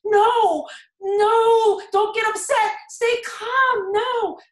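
high, loud urgent begging; wife begging husband to stay calm, loud pleading; pleading shout. Retold or imitated. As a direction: cry out to stop him, desperate.

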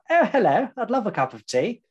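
high, hammed-up posh English accent; mock posh English. Mocking how Americans hear the English: posh English accent. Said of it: "We sound like".

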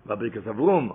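normal talking voice

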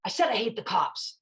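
voiced up and clear, imitating a defiant kid; defiant repeat. Boy answers the detective's challenge, openly defiant.

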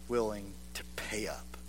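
breathy hushed whisper; soft whispered reproach. Acted. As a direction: whisper it like a confession. Whispered punchline in sermon.

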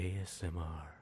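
soft, low murmur; quiet ASMR imitation; mock ASMR. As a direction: keep it hushed and close to the mic. Both playing at ASMR.

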